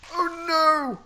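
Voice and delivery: loud, high yelp of alarm; high cry of dismay. Real, not acted. Gamer yelps loud and high as the mob gets away.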